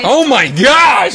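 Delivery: thrilled, drawn out cry; excited shout. Real, not acted. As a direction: shout with real delight. Guest joins the call.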